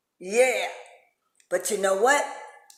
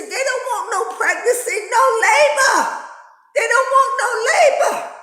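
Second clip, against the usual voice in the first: preaching; 12 semitones higher, 6 dB louder than usual. Acted. Preaching rising to a peak.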